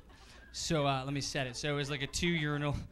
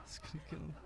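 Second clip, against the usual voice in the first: whispered laughing echo of the line; barely audible, laughing to himself; near-silent repeat; 16 dB quieter, faster than usual. Pretend. Comic repeats his own joke in a whisper.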